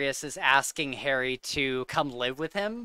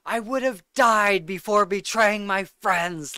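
higher, louder intense Sirius quote; 6 semitones higher, 5 dB louder than usual. Retold or imitated. Quoting Sirius to a friend, mock intense delivery. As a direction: channel Sirius's fury at the traitor. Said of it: "he's like".

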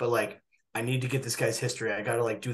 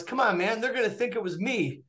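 much higher, whiny mock protest; embarrassed patient; 10 semitones higher than usual. Patient complains the nurses will blame him, higher whiny voice. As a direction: imitate the patient complaining, pitched up. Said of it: "And he goes".

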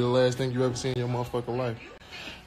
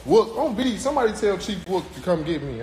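raised, louder call for help; 7 semitones higher, 5 dB louder than usual. Real, not acted. Asking for Chief Wook to come get him, calling out. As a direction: call out higher to get someone's attention.

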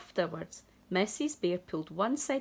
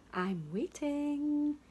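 slow high sing-song; drawn out, higher childish chant; sing-song waiting; 8 semitones higher, slower than usual. Acted. Sam waits.

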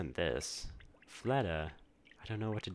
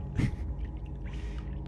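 breathy nervous laugh. Real, not acted. Laughs after scary messages.

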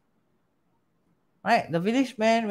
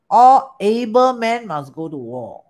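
formal proclamation; 6 dB louder, slower than usual. Retold or imitated. Reading the call-up letter.

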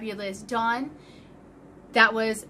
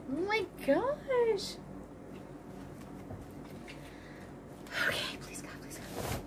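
hushed breathy prayer of hope; whispered hope; 9 semitones higher, 11 dB quieter, slower than usual. Real, not acted. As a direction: whisper a pleading little prayer. Hoping before opening the sack.

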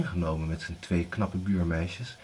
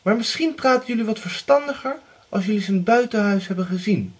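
high, loud scolding mother; sharp mother; 12 semitones higher, 6 dB louder than usual. Acted. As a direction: cut them down with a sneer. Widow still scolding.

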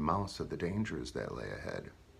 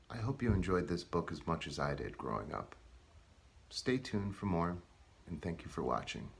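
plain reading voice

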